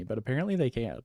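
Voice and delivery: ordinary delivery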